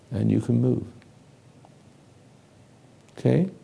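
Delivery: soft, calm trance voice easing them back; hypnotic wake-up suggestion. Acted. Hypnotist softly guiding the subject awake. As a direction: guide them gently out of hypnosis.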